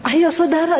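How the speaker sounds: ordinary talk, nothing marked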